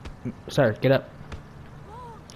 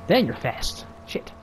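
ordinary talk

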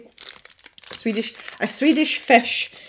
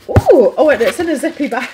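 excited; 5 semitones higher, 8 dB louder than usual. Real, not acted. Finds the zippy bag.

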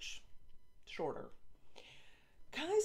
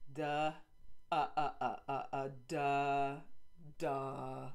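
dopey humming; 4 dB louder, slower than usual. Acted. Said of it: "hum in a way".

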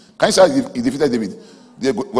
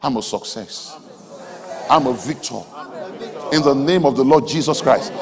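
ordinary talk

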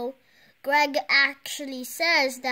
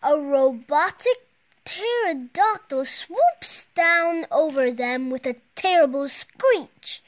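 ordinary delivery

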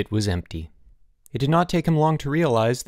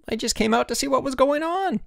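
high-pitched flustered excuse; distressed protest. A narrator's reading. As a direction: protest innocence in a high squeal. Perry excuses himself.

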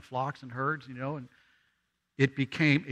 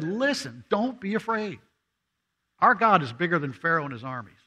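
higher and louder, rising fervour; fervent preaching; 7 semitones higher, 5 dB louder than usual. Acted. Moses urging the frightened people, preached higher and louder. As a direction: lift them up, preach it hard.

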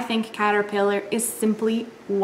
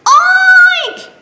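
high squealed oink; piglet oink cry; 10 dB louder than usual. Acted. Lost piglet crying out, high oink. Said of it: "cried Piglet".

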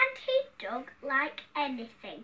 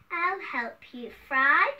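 loud, stretched-out words; hearty king's offer; 8 dB louder, slower than usual. Acted. Hungry king offers to chop for the cook, loud and emphatic. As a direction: boom it slowly, rising at the end.

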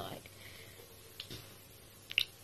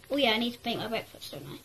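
ordinary talk

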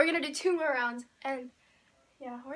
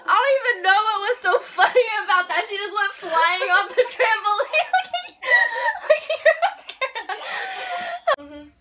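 giggles bursting through words; laughing hard; 4 semitones higher than usual. Real, not acted. Still laughing loudly after a fall.

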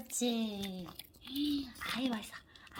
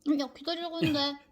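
fast exasperated; 5 semitones higher, 5 dB louder, much faster than usual. Real, not acted. Fast exasperated scolding of dog.

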